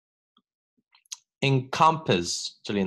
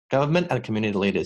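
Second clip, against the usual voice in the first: Government and community leaders run together quickly; read fast; much faster than usual. Shown as a demonstration. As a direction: rattle off the phrase at speed. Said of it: "要是读得快一点".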